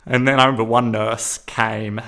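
usual speaking voice

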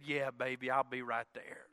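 imitated wife, low and soft; soft agreement. Wife on the phone agrees to come home, soft and low.

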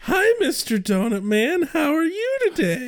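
put-on high, sing-song childlike hello; overly sweet pretend friendliness; mock cheery greeting. Greeting Mister Donut Man, high and mock cheery. Acting nervous greeting to killer.

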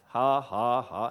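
low, deliberate "ha, ha, ha"; forced laugh. Retold or imitated. Man under the covers laughing at the devil, forced low laugh. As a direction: imitate a laugh he doesn't feel. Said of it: "I just began to laugh".

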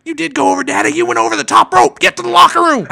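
very high, loud heel-referee tirade; Bill Alfonso impression. Heel referee ordering wrestler to the locker room, shrieking tirade. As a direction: scream the wrestler out of the ring. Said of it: "And in his best, Bill Alfonso said".

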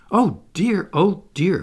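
high, loud exasperated lament; crying out. A narrator's reading. Wendy beset by the children's telling on each other, crying out. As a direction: wail mildly like an overburdened mother. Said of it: "cried Wendy".

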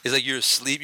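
usual speaking voice

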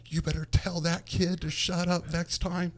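high, heated warning in a put-on voice; angry umpire. Umpire storming over, warning the coach about his catcher, heated. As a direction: play the furious umpire threatening.

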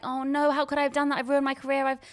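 high, loud, self-blaming mock panic; retold panic. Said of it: "I was terrified".

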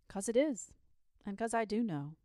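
ordinary delivery

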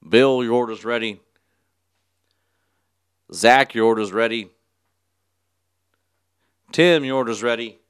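loud imitated cashier announcements; calling out orders. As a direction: call each customer to the counter.

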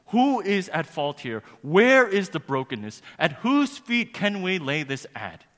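high, very loud preacher's cry; impassioned demand. Retold or imitated. Preacher voicing a lawyer's search for blame, shouted demand. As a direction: hurl each question out at full force.

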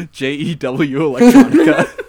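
spelling it out slowly through laughter; laughing spell-out. Real, not acted. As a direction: drag each letter out, laughing. Joking spelling out name.

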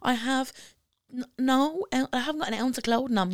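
high, whining complaint; whiny complaint. Retold or imitated. Two cold girls whining to each other about the walk home, high and sulky.

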